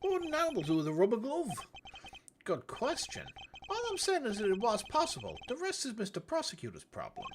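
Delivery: high-pitched, swooping cartoon voice; breezy game character. Talking baloney back at the prosecutor, breezy high character voice. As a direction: play a chirpy, cocky character up high.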